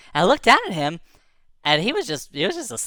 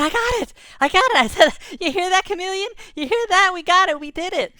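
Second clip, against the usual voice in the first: excited celebration; 11 semitones higher, 3 dB louder than usual. Retold or imitated. Celebrating the answer.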